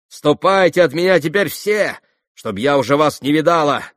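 ringing, excited order; impassioned dismissal. A narrator's reading. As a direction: send them all away feverishly. Acted dismissal of everyone.

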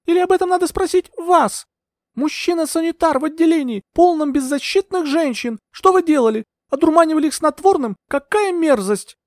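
very high, outraged woman's voice; indignant accusation. A narrator's reading. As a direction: accuse them, high and pressing. Heroine accuses the asylum doctor.

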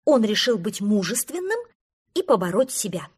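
ordinary delivery

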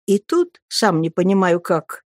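plain reading voice

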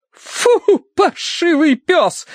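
high, drawn-out cry of disgust; horrified outcry. A narrator's reading. Mrs. shooing a mangy dog, crying out. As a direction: cry out as a theatrical, high-voiced matron. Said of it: "вскричала миссис Меринг".

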